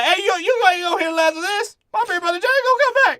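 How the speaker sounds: high, loud sassy lady voice, hammed up; shrill it back at him like an offended neighbor. Lady yelling back at racist.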